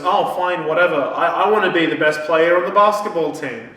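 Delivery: loud, dismissive give-in, then the demand; grudging. Retold or imitated. Girl giving in to the wish granter, grudging.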